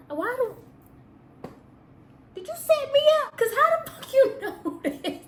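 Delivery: shrieking in disbelief; how did he know. Real, not acted. Shocked he guessed pregnancy.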